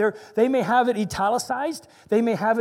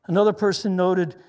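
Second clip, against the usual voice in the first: usual speaking voice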